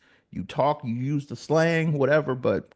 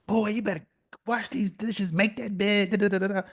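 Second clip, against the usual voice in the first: mock scolding mother, higher; scolding mom; 8 semitones higher than usual. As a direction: nag like an angry mom.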